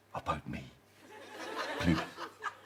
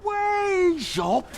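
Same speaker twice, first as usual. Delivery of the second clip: indignant cry; 13 semitones higher than usual. Acted. Director dismisses daughter's idea.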